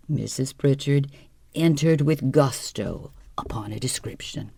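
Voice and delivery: ordinary delivery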